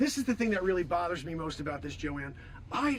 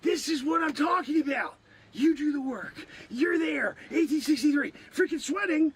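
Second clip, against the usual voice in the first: raised, worked-up complaint; exasperated rant; 5 semitones higher, 4 dB louder than usual. Acted. Man who did all the work, exasperated. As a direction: rant it high, comically exasperated. Rants about his unrewarded work.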